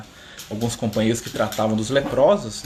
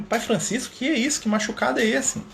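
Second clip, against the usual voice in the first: worried high surprise; 9 semitones higher than usual. Retold or imitated. Worried Frei Leão asks.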